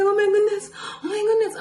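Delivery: high, loud panicked cry; gasping in sudden panic, repeating it; freaking out. Retold or imitated. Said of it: "I start freaking out".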